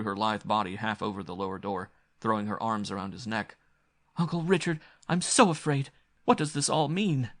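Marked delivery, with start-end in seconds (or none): 4.2-7.3 frightened. A narrator's reading. Frightened girl clinging to uncle.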